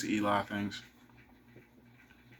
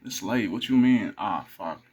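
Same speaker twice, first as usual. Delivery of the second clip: usual speaking voice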